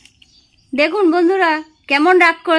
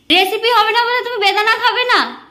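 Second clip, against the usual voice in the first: ordinary talk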